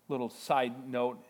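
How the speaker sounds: ordinary delivery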